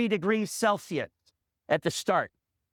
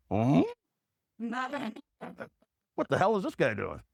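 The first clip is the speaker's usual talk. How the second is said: puzzled cow. Retold or imitated. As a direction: play a baffled onlooking cow, pitched down. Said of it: "cows looking at me going".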